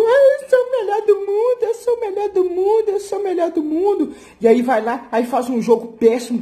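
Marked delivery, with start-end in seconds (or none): 0.0-4.1 mock bragging. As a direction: parody a cocky player, starting very high. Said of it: "E aí ele tá na fase boa". (imitação de jogador convencido, bem aguda no começo)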